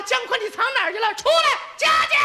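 wife yelling to find her husband; shouting wife. Acted.

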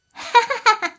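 high villainess cackle; pleased demon's ha-ha laugh; gloating laugh. Acted. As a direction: laugh in triumph, high-pitched.

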